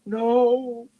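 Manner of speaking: very high, loud wail; heartbroken shriek. A narrator's reading. Mother dragon seeing children taken, heartbroken shriek. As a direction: shriek it out in anguish. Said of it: "shrieked Matafleur in a heartbroken voice".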